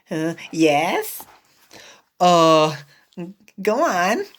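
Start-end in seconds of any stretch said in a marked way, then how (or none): none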